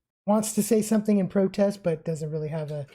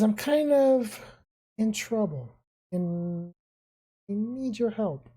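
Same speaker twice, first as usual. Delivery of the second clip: Rio in trouble; 3 semitones higher, slower than usual. Acted. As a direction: drag it out like a kid caught in trouble. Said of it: "He says".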